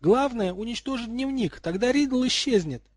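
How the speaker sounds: high-pitched urgent cry; urgent shouting. A narrator's reading. Hermione calls to Jeremy about the diary, urgent shout. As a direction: call out high and loud in alarm. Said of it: "крикнула Джерри".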